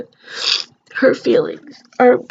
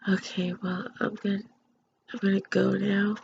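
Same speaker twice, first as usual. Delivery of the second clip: sleepy, much softer sign-off; drowsy and fading, eyes closing; nodding off; 3 semitones lower, 10 dB quieter than usual. Real, not acted. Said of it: "I'm really tired".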